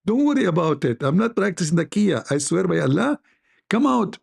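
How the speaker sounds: a cat swearing innocence to lure the mouse out; pleading cat. Acted. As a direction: sweet-talk the mouse out in a squeaky high voice.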